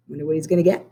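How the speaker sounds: normal talking voice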